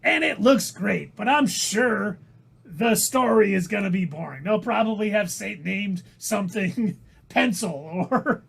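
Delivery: emphatic put-on comedian voice; exaggerated impersonation with strained peaks and falling endings; Gilbert Gottfried. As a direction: argue it as an abrasive comic. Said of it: "we're gonna talk like this".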